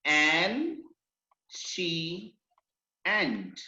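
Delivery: "एंड, शी, एंड" as three isolated syllables with pauses. Shown as a demonstration. Teacher counting out syllables for students, syllable by syllable. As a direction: split "एंड शी एंड" slowly, one beat at a time. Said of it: "more than one syllable word".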